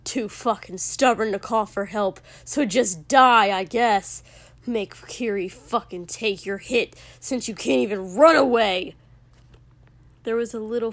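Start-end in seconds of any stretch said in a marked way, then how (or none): 0.0-9.0 loud, scornful tirade with shouted peaks; furious rant. A narrator's reading. Furious man accusing someone too stubborn to call for help, scornful. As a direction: build to an angry shout. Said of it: "looking utterly furious".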